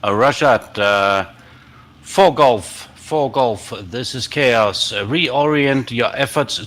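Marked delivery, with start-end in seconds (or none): none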